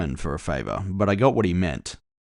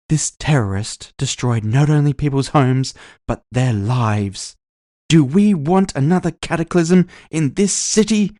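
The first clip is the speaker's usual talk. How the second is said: higher, louder orator building to a cry; rousing rally speech; 7 semitones higher, 6 dB louder than usual. Acted. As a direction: whip up the crowd, last question soaring high.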